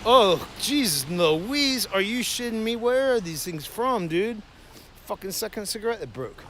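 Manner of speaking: can't believe it broke again; exasperated. Real, not acted. Second cigarette broke, exasperated.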